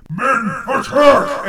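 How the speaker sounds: rising to a shouted attack order; rally the troops, shouting; battle shout. Acted. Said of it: "And so he says".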